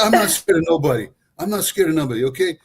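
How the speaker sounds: proud father who won't back down; defiant dad. Retold or imitated. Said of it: "He said".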